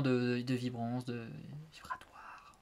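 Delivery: slow fading whisper; breathy hush as a joke. Pretend. Drifts into joke ASMR.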